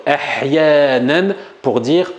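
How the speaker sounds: slow, deliberate "ahyanan", every syllable spaced; slow teaching delivery. Shown as a demonstration. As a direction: spell it out for the class by voice. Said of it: "je prononce avec vous".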